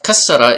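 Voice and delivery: kasara with the s held long; doubled S. Shown as a demonstration. Teacher naming measure two, kasara with long s. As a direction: lean loudly on a doubled s in kasara. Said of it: "kasara is measure two".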